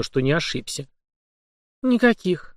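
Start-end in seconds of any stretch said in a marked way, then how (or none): 1.8-2.6 high, abrupt denial; sharp. Acted. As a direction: snap it out quickly. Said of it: "резко".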